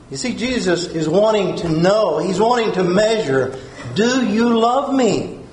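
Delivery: ordinary delivery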